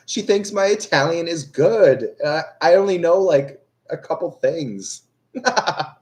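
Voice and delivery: talking through laughter, high-pitched; amused, half laughing. Real, not acted. Laughing at the compliment.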